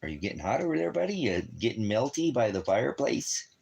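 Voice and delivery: normal talking voice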